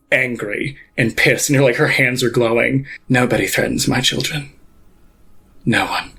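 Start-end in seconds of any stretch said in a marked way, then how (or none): 3.1-6.1 low, quiet icy threat; cold furious Pontiff. Acted. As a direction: let her menace sit low and still.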